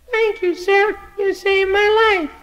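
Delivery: very high, small grateful voice; tiny child voice, thankful; little snowflake thanking. Acted. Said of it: "He said".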